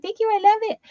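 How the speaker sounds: high, delighted thank-you. Retold or imitated. Helper thanking her for the gift, high and delighted. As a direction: gush thanks like a thrilled helper. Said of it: "She's like".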